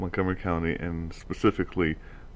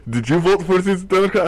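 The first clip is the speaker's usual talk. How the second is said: put-on much higher, louder Russian accent; 11 semitones higher, 7 dB louder than usual. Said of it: "now I am also speaking in a Russian accent".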